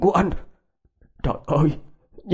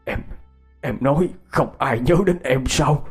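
higher, emotional plea; choking up; 3 semitones higher than usual. A narrator's reading. Husband telling ghost of twenty years' searching, choking up. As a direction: feelings welling up as he pleads. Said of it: "Giọng ông bắt đầu nghẹn lại".

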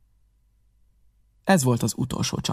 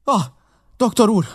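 high, louder call of relief; crying out; 10 semitones higher, 5 dB louder than usual. A narrator's reading. Mother and Jim call to the doctor, higher and louder. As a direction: hail the doctor as he comes in. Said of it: "kiáltottunk föl".

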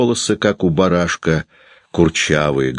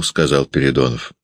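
ordinary delivery

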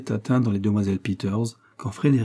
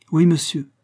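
high, louder, lightly acted tearful answer; 7 semitones higher, 6 dB louder than usual. A narrator's reading. Agnès confirming her refusal to her suitor, mildly tearful. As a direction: answer as if through tears. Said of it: "dit Agnès tout en pleurant".

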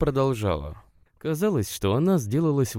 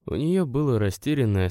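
usual speaking voice

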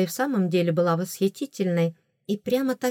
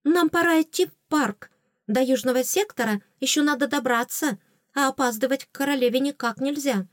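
higher, urgent voice; agitated; 6 semitones higher than usual. A narrator's reading. Lady-in-waiting urges heroine to the park, agitated urgency. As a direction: hurry her along anxiously. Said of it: "взбудораженная Лира Марасти".

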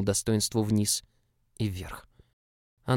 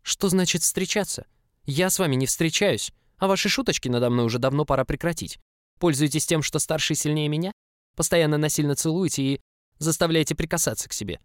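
higher-pitched indignant outburst; exploding in anger; 7 semitones higher, 4 dB louder than usual. A narrator's reading. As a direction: fire off accusations, voice raised in pitch. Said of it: "я при этом в буквальном смысле взорвался". (возмущённо взорвавшись)

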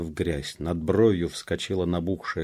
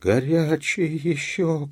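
high, slow, drawn-out exclamation; crying out; 9 semitones higher, 3 dB louder, slower than usual. A narrator's reading. Man turning over the corpse, crying out. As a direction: cry it out high and stretched. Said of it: "крикнул вслух".

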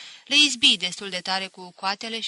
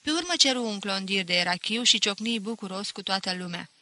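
ordinary delivery

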